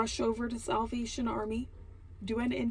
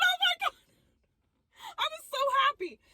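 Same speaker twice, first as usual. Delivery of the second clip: high, teary joy; tearful burst of happiness; overjoyed crying; faster than usual. Real, not acted. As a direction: voice breaking with joy.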